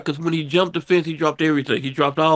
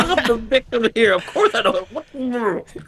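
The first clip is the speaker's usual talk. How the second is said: high protest with laughter, slowing at the end; mock indignation; 7 semitones higher than usual. Robbery victim protesting to the cop, laughingly indignant. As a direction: plead innocence in a raised, laughing voice.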